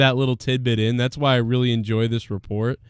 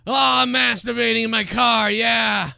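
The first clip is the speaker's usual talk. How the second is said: masturbating man; 10 semitones higher, slower than usual. Retold or imitated. Pretending to be the man in his car, high moaning shouts. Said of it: "he's like".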